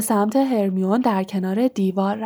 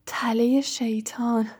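whisper; 5 dB quieter, slower than usual. Acted. Hermione muttering to herself, thinking.

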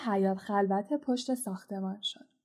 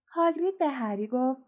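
plain reading voice

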